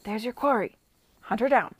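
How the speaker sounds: loud, imperious command; commanding order. Acted. Lady Denham ordering her nephew to pursue, loud and commanding. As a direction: order him after her like a hound.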